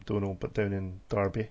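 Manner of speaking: usual speaking voice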